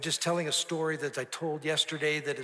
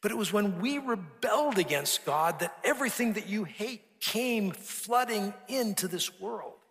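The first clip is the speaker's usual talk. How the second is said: impassioned preaching; 5 semitones higher, 4 dB louder than usual. Retold or imitated. Pastor preaching to the hairdresser.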